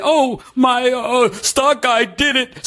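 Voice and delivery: hammed-up impression of a clueless stock holder; mocking quote. Mocking Dave Portnoy's admission to his viewers, hammed-up dopey voice. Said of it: "this clown is gonna sit here and say".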